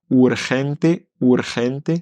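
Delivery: "urgente" with a light rolled r; light trill on the r in "urgente". Shown as a demonstration. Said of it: "celui-là, il est assez léger".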